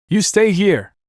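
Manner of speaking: high-pitched, forceful command; stern order. A narrator's reading. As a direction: lay down an order in anger. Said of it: "Tigress ordered".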